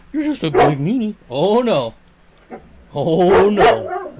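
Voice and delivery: playful pretend hurt at the barking dog. Real, not acted. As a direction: tease the dog for being mean. Mock scolding the dog.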